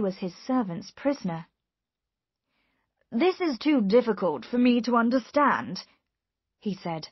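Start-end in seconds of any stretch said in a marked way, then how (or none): 3.1-5.8 raised, emphatic bafflement; perplexed magistrate. A narrator's reading. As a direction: protest confusion with wide pitch swings.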